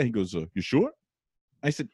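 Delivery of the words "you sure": higher-pitched Brooklyn query; Brooklyn detective dad. Retold or imitated. Brooklyn dad double-checks with his son, lightly mimicked doubt. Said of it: "he talks like a detective from Brooklyn".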